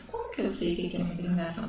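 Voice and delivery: ordinary delivery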